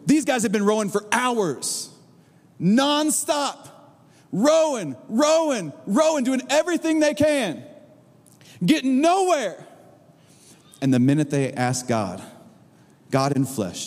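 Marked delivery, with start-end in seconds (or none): 0.1-9.6 high, driving exasperation; emphatic preaching. Real, not acted. Preacher recounting hours of fruitless rowing, high and emphatic. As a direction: punch each repeated word of the struggle.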